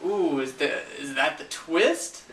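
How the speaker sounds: sarcastic swooping curiosity; mock wonder. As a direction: play the viewer pretending to be intrigued. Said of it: "and you're like".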